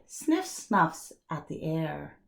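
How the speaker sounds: plain reading voice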